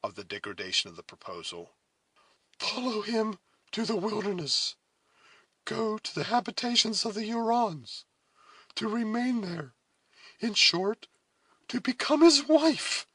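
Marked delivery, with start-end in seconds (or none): 2.6-13.1 high, grave voice, rising at the end; sinking accents. A narrator's reading. Cora revealing the Huron's proposal, grave distress. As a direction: spell out a degrading fate gravely. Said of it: "her accents sinking under a deep consciousness".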